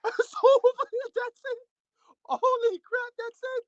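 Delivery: very high-pitched disbelief; excited outburst. Real, not acted. Fight fan stunned that it is over, excited outburst. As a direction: squeal it out, real shock at the stoppage. Live reaction to sudden knockout.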